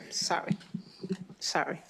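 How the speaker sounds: normal talking voice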